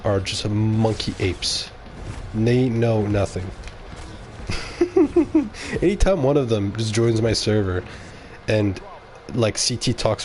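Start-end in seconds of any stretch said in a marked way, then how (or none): 4.4-5.8 high-pitched stuttering giggle. Real, not acted. Streamer amused by his own jibe, high giggle. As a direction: giggle in a string of high bursts.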